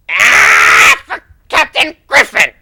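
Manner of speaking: loud charging war cry in another voice; battle cry. Acted. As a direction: roar it as you charge in.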